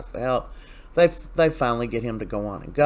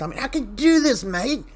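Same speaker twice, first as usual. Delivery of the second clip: mock pleading, high and put-on; high-pitched impression; 9 semitones higher than usual. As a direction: voice leaping up high in imitation. Acting out Xander urging Philip.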